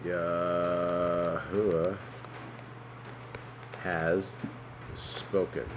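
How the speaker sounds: each word drawn out slowly; slow dictation pace. Real, not acted. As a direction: spell it out word by word as you type. Typing the verse.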